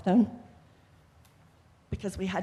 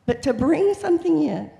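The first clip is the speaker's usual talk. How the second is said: teacher voice; 6 semitones higher, 7 dB louder than usual. Real, not acted. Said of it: "I'm used to speaking to kids".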